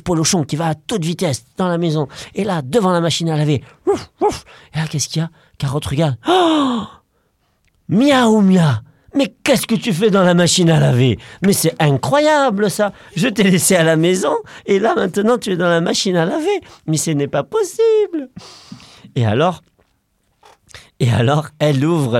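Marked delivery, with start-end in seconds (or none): 3.9-4.4 high excited woofs; dog bark. Acted. Dog showing the girls, yapping. 6.3-18.3 put-on astonished girl. Girl finding her cat in the washer, astonished.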